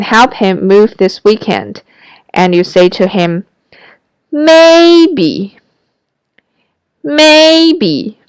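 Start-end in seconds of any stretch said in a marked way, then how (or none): 4.3-8.2 maybe pitched high in the range; high reluctant maybe to a friend. Shown as a demonstration. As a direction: lift maybe up to your top note. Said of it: "So may with a high voice".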